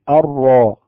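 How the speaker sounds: الراء with one light tap of the r; single trilled r. Shown as a demonstration. Teacher showing one correct r tap. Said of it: "حدث تكرار واحد".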